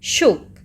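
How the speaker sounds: शुक with a very brief oo; short oo. Shown as a demonstration. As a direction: cut the vowel of शुक short. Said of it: "इसमें एकदम short में है".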